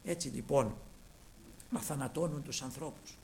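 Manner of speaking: usual speaking voice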